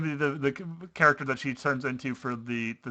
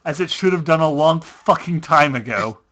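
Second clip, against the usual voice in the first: loud dramatic narration; 7 dB louder than usual. Acted.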